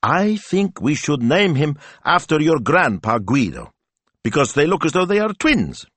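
put-on singsong mischievous child voice. A narrator's reading. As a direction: announce a cheeky idea, pitched high. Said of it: "eyes danced with mischief".

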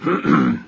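low, important throat-clearing; self-important ahem; impressive throat-clear. A narrator's reading. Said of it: "He cleared his throat impressively".

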